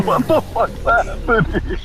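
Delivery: high, bewildered cry of confusion; baffled outburst. Retold or imitated. Woman watching the fight, blurting it out high and baffled. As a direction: blurt it out like she just walked in on chaos.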